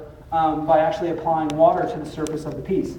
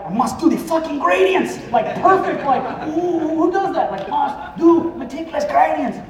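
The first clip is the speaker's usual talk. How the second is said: high-pitched, hammed-up perfectionist; mock a fussy young German woman, pitched way up; obsessive German perfectionist; 8 semitones higher than usual. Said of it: "why is she German? Because she was like".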